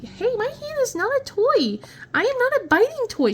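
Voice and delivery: high, mock-stern scold to a kitten; high playful scolding.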